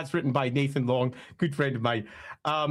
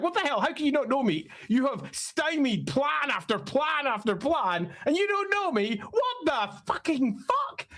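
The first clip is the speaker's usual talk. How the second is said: high-pitched mock indignation; Thanquol freaking out; 10 semitones higher than usual. Villain unrecognized after plan upon plan, freaking out. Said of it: "and he freaks out".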